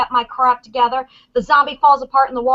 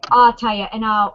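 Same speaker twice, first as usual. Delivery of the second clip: ordinary talk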